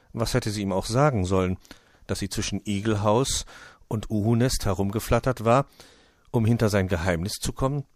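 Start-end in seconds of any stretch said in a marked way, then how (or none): none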